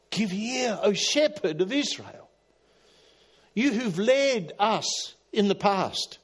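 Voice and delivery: psalm plea. Retold or imitated. Preacher repeats the psalm to God, high slow plea. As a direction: lift the psalm up slowly.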